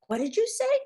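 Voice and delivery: high, loud disbelief; mother stunned by her daughter's words, high and loud; shocked. A narrator's reading.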